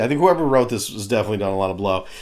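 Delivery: ordinary talk, nothing marked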